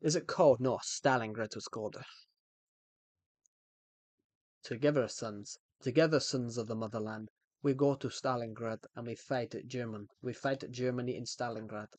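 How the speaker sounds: Russian accent, rallying the comrades. Shown as a demonstration. Soldier to sons of the motherland, Russian accent. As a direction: address your men in a mock Russian accent. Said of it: "do a Russian accent".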